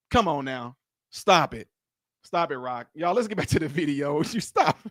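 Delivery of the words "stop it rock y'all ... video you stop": normal talking voice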